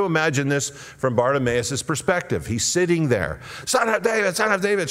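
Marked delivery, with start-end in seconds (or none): 3.6-4.9 high, urgent cry for help; pleading cry pitched way up. Acted. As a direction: call out high-pitched and insistent. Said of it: "he cries out the more".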